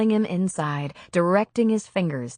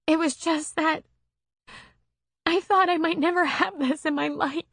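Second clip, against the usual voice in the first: put-on higher, emotional young woman; heroine's voice; 10 semitones higher than usual. A narrator's reading. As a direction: voice her as she confesses, moved. Narrator voices Gina's emotional confession.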